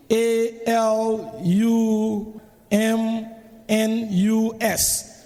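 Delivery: slow letter-by-letter spelling. Shown as a demonstration. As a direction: spell each letter slowly. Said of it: "hear the moderator spell".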